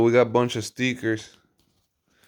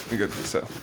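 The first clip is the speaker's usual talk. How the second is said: ordinary delivery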